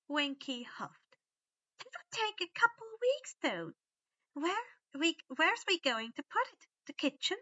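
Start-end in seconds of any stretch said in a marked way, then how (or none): 1.8-7.4 high, fussy elf; house elf voice. A narrator's reading. Winky worries to Dobby, high elf voice. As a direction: fret about the body.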